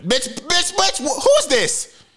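high, loud mock yell; shocked yelling. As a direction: yell in disbelief at the voice.

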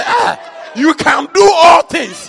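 high, loud, slow proclamation; booming pastor's encouragement; preacher's shout. Retold or imitated. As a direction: proclaim it with total confidence.

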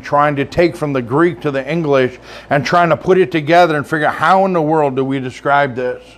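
ordinary delivery

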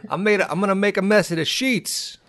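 high-pitched mock South Boston accent. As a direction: ham up a Boston lover's voice. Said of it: "doing the South Boston accent".

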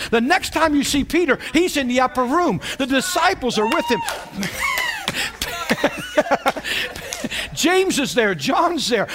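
preaching at full force. Acted. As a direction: lift the congregation with it.

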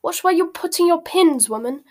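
loud, high angry bellow. A narrator's reading. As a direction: yell crossly at a clumsy fitter. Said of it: "bellowed Malfoy".

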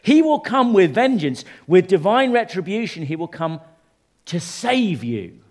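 high, loud proclamation; forceful Scripture reading. Real, not acted. Preacher announces God's vengeance to the congregation, forceful reading.

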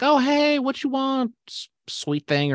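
high, slow mock Southern lady; Southern cashier. Retelling a liquor store clerk's greeting in a high mock Southern voice. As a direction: drawl it like a sweet Southern cashier. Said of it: "she was like".

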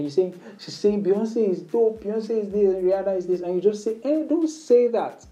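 light-hearted banter demo; playful teasing. Shown as a demonstration. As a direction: tease her as if it's nothing. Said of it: "Say it so casually".

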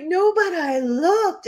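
put-on high, loud, slow child insisting; high drawn-out child protest; little-girl voice. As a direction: plead guilt like a small child. Child self upset.

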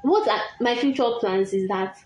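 ordinary delivery

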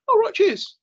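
high mocking thanks, loud; sarcastic. Sarcastic reply to passers-by.

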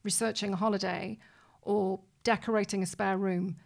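ordinary delivery

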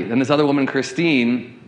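normal talking voice

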